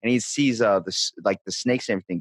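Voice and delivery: ordinary delivery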